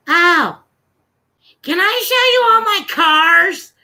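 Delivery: put-on high, loud, drawn-out child voice; eager little kid. Little kid wanting to show off cars, eager child voice. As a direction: imitate a kid bursting to show off. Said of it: "He goes".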